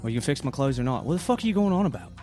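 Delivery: ordinary delivery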